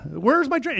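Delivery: usual speaking voice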